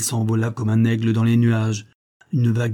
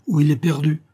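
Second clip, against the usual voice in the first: higher-pitched alarm; urgent warning; 6 semitones higher, 3 dB louder than usual. A narrator's reading. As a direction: warn of disaster, voice raised. (alerte, voix plus haute)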